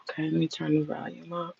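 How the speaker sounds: breathy off-mic whisper; a real, unvoiced whisper aside. Real, not acted. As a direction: whisper it to yourself while fiddling with the volume. Aside while fixing the stream.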